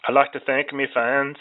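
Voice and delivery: a quick Irish brogue thanking the fans; Conor impression. Host jokes about the plea deal, mimicking Conor in a light Irish accent. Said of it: "Fuck off, Conor".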